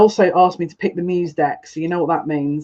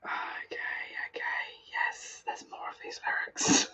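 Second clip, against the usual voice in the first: very soft, voiceless whisper; tearful whisper; 17 dB quieter than usual. Real, not acted. Reader collecting herself after tears over lyrics, whispering. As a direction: whisper it, barely audible after crying.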